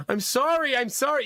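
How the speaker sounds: put-on high, repeated kid apology; imitating a child's panicked sorry; apologizing toddler. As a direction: mimic a little boy saying sorry.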